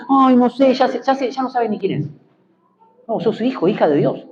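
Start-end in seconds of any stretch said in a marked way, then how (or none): 0.1-2.1 high-pitched, dithering imitation of a doubter; mock self-doubt. Preacher imitating a believer unsettled by criticism, mock self-doubt. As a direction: act out someone thrown into confusion, pitched up. Imitating someone whose identity is shaken.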